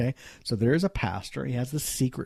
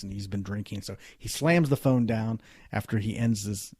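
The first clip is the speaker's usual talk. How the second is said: ordinary talk, nothing marked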